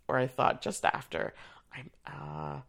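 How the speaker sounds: ordinary talk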